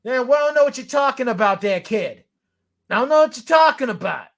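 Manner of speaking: put-on high, loud Boston accent; hammed-up Boston ballplayer impression; Schwarber Boston impression. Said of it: "Do a Schwarber Boston impression".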